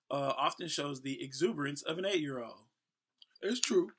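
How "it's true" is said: higher and louder, proudly; proud agreement. Real, not acted. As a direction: back up the article with pride. Confirms the article about himself.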